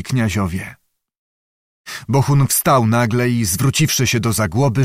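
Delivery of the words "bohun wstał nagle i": plain reading voice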